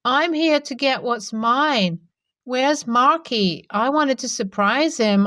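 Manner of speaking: put-on slow, slurred drunk voice; drunk slurring. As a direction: slur it out, tipsy and pleased. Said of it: "slurred out".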